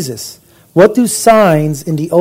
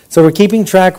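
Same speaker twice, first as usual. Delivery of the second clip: normal talking voice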